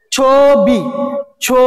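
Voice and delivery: ছবি stretched as "chhoo-bi", then ছো alone; ছবি and ছো with the o held; o-sound demo. Shown as a demonstration. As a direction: sound out ছবি with a long closed o, then isolate ছো. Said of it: "তাহলে কি ওকার হয়েছে".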